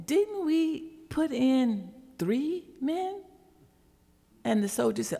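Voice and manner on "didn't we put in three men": high, slow, astonished question; disbelief, looking into the furnace; lightly voiced astonished king; astounded king. Astounded king asks soldiers.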